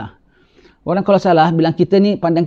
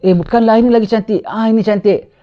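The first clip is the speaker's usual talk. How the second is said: sindiran; 5 semitones higher than usual. Acted. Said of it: "dia perli kaum dia".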